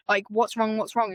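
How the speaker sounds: usual speaking voice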